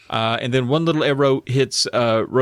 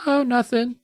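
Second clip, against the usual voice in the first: put-on high girl voice; 11 semitones higher than usual. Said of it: "they'd be like".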